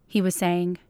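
plain reading voice

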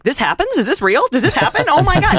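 high, laughing amazed questions; shocked disbelief. Retold or imitated. Viewer shocked by the film.

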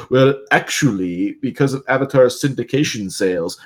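lightly voiced nitpicker lecturing; pedantic know-it-all. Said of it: "if you're gonna come out and be like".

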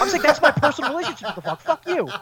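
high, loud, rushed protest; mock outrage. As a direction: snap back in playful indignation, half laughing. Mock outrage at being teased.

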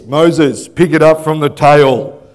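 low, loud divine command; God ordering Moses, low and loud; booming God. Acted. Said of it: "So he says".

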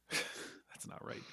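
barely audible, under the breath; muttered aside. Real, not acted. Co-host doubting their own word, muttered very softly. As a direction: mutter it slowly to yourself, almost voiceless. Muttered self-correction after the name.

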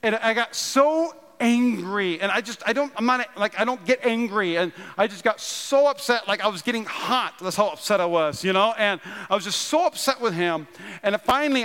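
ordinary talk

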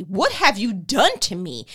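angry Pharaoh demand. A narrator's reading. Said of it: "he demanded".

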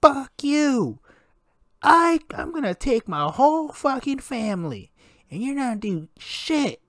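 high-pitched mock yell of a furious customer; angry customer yelling. Store worker imitating a furious customer, mock yell. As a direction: bark it like an entitled shopper. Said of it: "People are yelling".

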